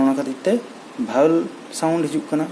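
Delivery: normal talking voice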